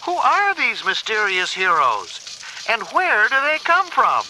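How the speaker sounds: lightly voiced TV reporter. A narrator's reading. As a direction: present it like breaking news. April's news report continues.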